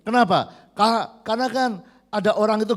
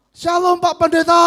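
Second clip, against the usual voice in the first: bright, loud, high hello; cheerful greeting; 8 semitones higher, 7 dB louder than usual. Retold or imitated. Young church member cheerfully greeting the pastor from his car. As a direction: call out a happy greeting.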